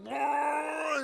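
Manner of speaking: loud, high motor rumble through the lips; a speedboat engine roaring off the dock; engine roar imitation. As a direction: buzz the lips like a racing boat engine.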